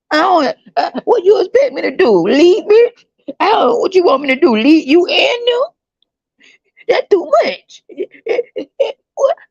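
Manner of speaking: high, teary mock sobbing woman; woman blubbering that she can't do this, mock sob; mock crying woman. Woman crying over pregnancy.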